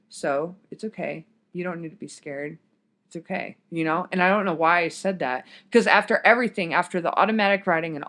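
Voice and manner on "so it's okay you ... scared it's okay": soft, calming voice, quieter; soothing reassurance. Retold or imitated. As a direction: gently reassure a frightened friend. Calming terrified crying friend.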